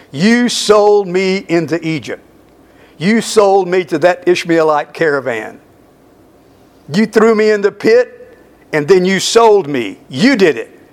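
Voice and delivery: a mild, building accusation with "You" stressed each time; accusing emphasis. Acted. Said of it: "Now he made that an emphasis".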